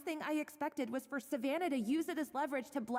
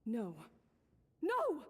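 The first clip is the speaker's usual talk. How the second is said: disbelieving outcry; 3 semitones lower than usual. Acted. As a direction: refuse to believe it, rising. Denial building to outcry.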